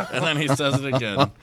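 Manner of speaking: ordinary talk, nothing marked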